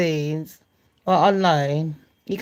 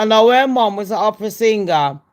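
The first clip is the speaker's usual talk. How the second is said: usual speaking voice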